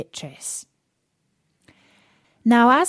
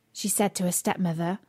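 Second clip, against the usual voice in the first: plain reading voice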